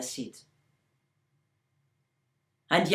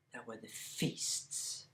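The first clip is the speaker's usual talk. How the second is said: soft whispered aside; 9 semitones lower, 15 dB quieter than usual. A narrator's reading. Storyteller whispers to the listeners. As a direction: let it fall to a whisper. Storyteller hushes a key line.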